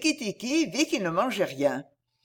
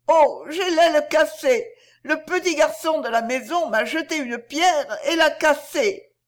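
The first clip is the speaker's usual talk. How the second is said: high, loud wail of a hurt bird; 7 semitones higher, 3 dB louder than usual. Acted. Darzee's wife feigns a broken wing to lure Nagaina, wailing. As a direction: cry out in high, sustained lament. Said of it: "et à gémir".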